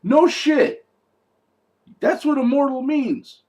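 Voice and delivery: loud, high-pitched disbelief at the obvious; incredulous outburst, voice shooting up; exasperated sarcasm. Real, not acted. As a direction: shout it like it's painfully obvious. Exasperated at a dumb movie line.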